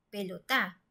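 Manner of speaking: pelotá with the stress pushed onto the final "tá"; stress on last syllable. Shown as a demonstration. Teacher showing pelotá as we don't say it, final syllable stressed. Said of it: "tendríamos que pronunciar pelotá".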